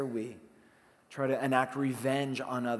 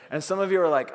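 normal talking voice

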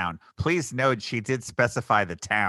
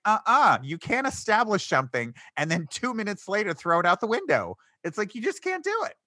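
indignant objection; 10 semitones higher than usual. Retold or imitated. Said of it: "I'm like".

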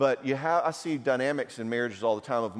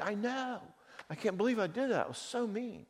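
higher, softer, ashamed; sheepish apology; 10 semitones higher, 7 dB quieter than usual. Retold or imitated. Husband admitting to wife he was mean, meek and soft. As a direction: own it meekly and softly.

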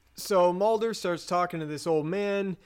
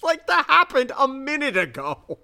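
loud, high-pitched disbelief; exasperated; 9 semitones higher, 5 dB louder than usual. Real, not acted. Podcaster still ranting about Mulder's question, exasperated. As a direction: cry out at the sheer stupidity.

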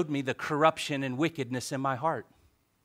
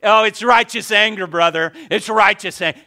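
higher and louder, sarcastic; mocking churchgoer; 9 semitones higher, 13 dB louder than usual. Pastor mocking his church's excuses, sarcastic. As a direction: parody the self-excusing believer. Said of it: "Yeah, all of you".

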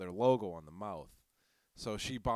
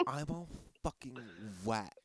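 mock sultry; 5 dB quieter than usual. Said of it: "It's pronounced wet".

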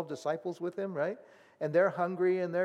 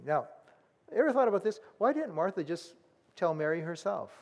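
ordinary talk, nothing marked

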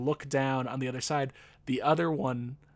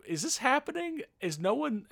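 high, incredulous; can't believe what he's seeing; retold disbelief; 11 semitones higher than usual.

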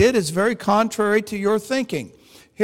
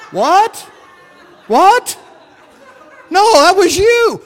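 high, loud mock astonishment; incredulous denial; 9 semitones higher, 8 dB louder, slower than usual. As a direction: throw it straight back in shocked disbelief. Retold argument over who prophesied.

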